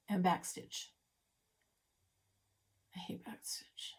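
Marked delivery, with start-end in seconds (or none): 2.9-3.9 grumbled whisper. Real, not acted. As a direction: whisper it as a confession. Grumbling aside.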